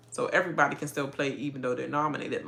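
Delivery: usual speaking voice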